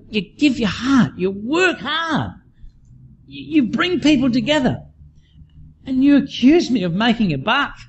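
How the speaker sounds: high-pitched hurt protest, reliving it; upset. Retold or imitated. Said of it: "I was upset".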